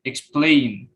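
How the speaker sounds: "explain" with PLAIN stronger and falling; second-syllable stress. Shown as a demonstration. Student presenter showing verb stress, "explain" stressed on PLAIN. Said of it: "Most verb have stress on".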